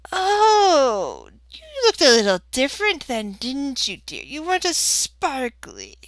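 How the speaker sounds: put-on high old lady voice, fondly recalling; smiling old woman. [name] remembering how Tonka used to look, high-voiced.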